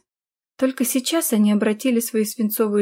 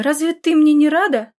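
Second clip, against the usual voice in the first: higher, louder hurt question; indignant; 6 semitones higher, 5 dB louder than usual. A narrator's reading. Daughter asks mother for some welcome, indignant. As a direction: challenge her in raised protest. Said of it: "возмутилась Ада".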